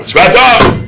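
high, rising call; acted emphatic outburst; insistent warning. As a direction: raise the pitch sharply to demand attention.

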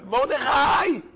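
high yell across the pitch; coach yelling. Retold or imitated. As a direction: call the dreaming defender sharply. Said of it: "צועק לו המאמן".

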